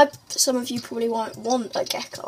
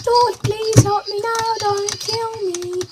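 high-pitched begging for mercy; playful pleading; 9 semitones higher, 4 dB louder, slower than usual. Pretend. Player begging game attackers for mercy, playfully pleading. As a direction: plead for your life, drawn out at the end.

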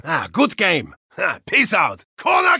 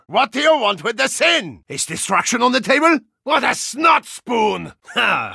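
ordinary delivery

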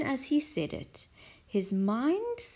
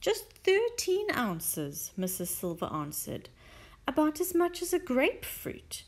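wistful Mrs Silver; 6 semitones higher than usual. Acted.

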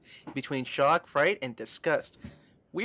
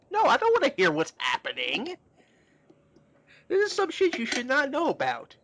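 panicked refusal; 9 semitones higher than usual. Acted. Acted Ringo refusing to listen.

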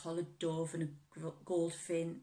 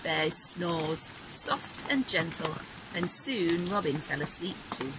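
put-on gentle read-aloud for children; storybook reading; 9 dB louder, slower than usual. A narrator's reading. Teacher reading a bedtime story to her class.

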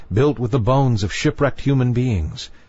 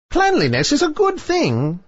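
very high witch voice; high-pitched, self-satisfied witch; sea witch. A narrator's reading. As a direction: remark it as a pleased witch, pitched way up.

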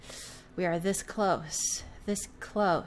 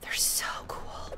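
breathless hushed whisper of awe; awed whisper; 4 dB quieter, slower than usual. Real, not acted. Player marvels at the synths in an awed whisper. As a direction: whisper it like you're starstruck.

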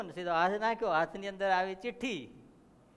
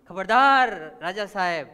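loud, drawn-out cry of alarm; warning shout; 14 dB louder, slower than usual. Acted. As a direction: bellow a slow, urgent warning to the king. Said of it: "ધોબીએ બૂમ પાડી". (ચેતવણીની મોટી બૂમ)

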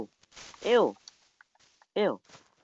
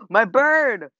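alarmed cry; 8 semitones higher, 4 dB louder than usual. Real, not acted. Bird falls.

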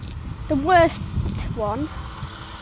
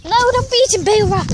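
telling off; 8 semitones higher, 4 dB louder than usual. Real, not acted. Scolding a chicken.

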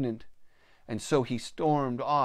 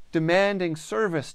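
ordinary delivery, nothing marked

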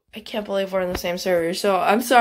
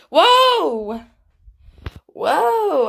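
surprised whoa; 6 semitones higher, 3 dB louder than usual. Real, not acted. Surprise at trade reveal.

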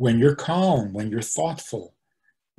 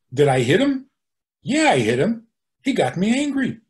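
acted defiance, pitch climbing high; mock indignant justification; 7 semitones higher, 4 dB louder than usual. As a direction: own up brazenly, as if fully justified. Acting out someone justifying hitting.

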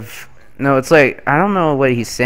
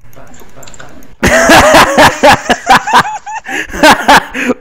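wild high laughter; 13 semitones higher, 4 dB louder than usual. Real, not acted.

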